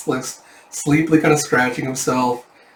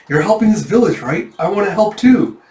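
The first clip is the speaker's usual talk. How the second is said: much higher and louder, keen; eager plea; 8 semitones higher, 6 dB louder than usual. Acted. Yona asking Yun to let her help, eager. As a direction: beg to be allowed to help.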